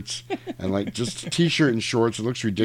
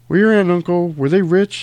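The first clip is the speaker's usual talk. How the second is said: loud mock question; 8 semitones higher, 7 dB louder than usual. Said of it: "And he goes".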